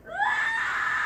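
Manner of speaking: very high, loud sustained scream; out-scream the other man. Acted. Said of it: "he screams back at him".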